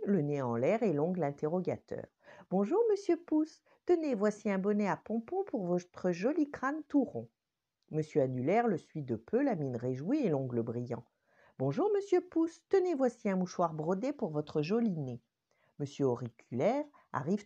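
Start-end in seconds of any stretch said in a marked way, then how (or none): 2.5-7.3 high, perky greeting voice; a nosy little character chirps his hello; curious little finger voice. Acted. Monsieur Index greets Monsieur Pouce, high and perky. 11.6-15.2 high, perky greeting voice; a nosy little character chirps his hello; curious little finger voice. Acted. Monsieur Index greets Monsieur Pouce, high and perky.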